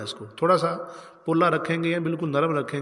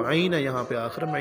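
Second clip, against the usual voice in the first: ordinary talk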